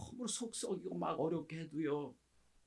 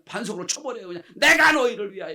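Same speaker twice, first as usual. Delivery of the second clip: higher, louder angry shout; angry outburst; 7 semitones higher, 13 dB louder than usual. Acted. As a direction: snap it out in frustration. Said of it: "이렇게 화를 냅니다".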